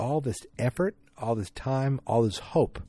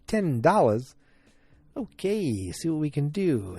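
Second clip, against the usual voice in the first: ungrateful; 6 semitones higher than usual. Retold or imitated.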